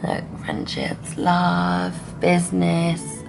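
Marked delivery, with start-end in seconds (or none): none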